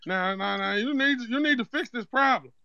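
a lightly put-on accent, prank caller opening the complaint; Zeke from Power. Prank caller tells the home cook about old food, lightly put-on accent. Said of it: "why is he talking like Zeke off power".

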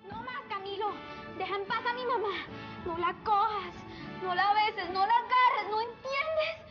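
upset pleading. Acted. Daughter confronts mother's suitor.

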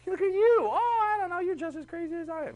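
one tyrant teasing the other in a squeaky put-on voice; mocking dictator voice. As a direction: play a dictator mocking his rival, way up high. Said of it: "they should have realized".

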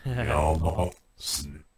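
reversed robotic alien gibberish; garbled backwards alien speech. Shown as a demonstration. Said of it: "Your own alien language and voice".

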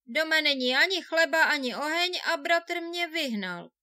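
lamenting. A narrator's reading. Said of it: "naříkal si".

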